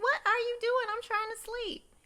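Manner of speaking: irritated Miranda. Retold or imitated. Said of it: "Miranda's like".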